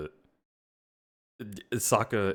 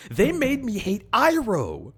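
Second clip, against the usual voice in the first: high, louder slow complaint; indignant outburst; 14 semitones higher, 6 dB louder than usual. Real, not acted. Ranting about the show, indignant and loud. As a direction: protest it with outrage.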